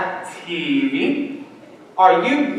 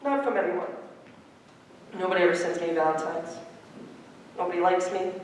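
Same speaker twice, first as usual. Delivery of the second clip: much softer, deflated and down; dejected; 4 semitones lower, 12 dB quieter, faster than usual. Real, not acted. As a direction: let it sink in, defeated. Boy sinking into dejection.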